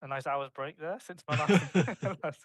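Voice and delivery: words broken by laughter; laughing while talking. Real, not acted. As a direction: giggle through the line. Jokes about his long break.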